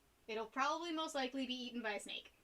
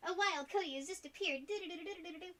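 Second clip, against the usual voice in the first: higher mock announcer with sung jingle; game encounter announcement; 5 semitones higher than usual. Greeting Cody joining the chat, mock game encounter announcement. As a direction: announce a wild creature, then do-do-do theme.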